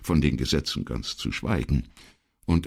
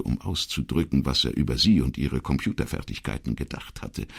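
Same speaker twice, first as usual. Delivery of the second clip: usual speaking voice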